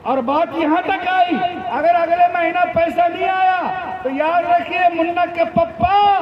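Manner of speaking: mock warning from an acted wife; threatening wife. Wife warning her child's father about money, threatening. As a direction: deliver an insistent, lightly acted ultimatum.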